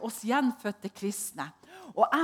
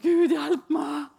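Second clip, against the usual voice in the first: slow, higher, louder plea; pleading prayer; 5 semitones higher, 6 dB louder, much slower than usual. Retold or imitated. Woman in church begging God for help, slow and louder. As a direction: drag out a cry for help to God.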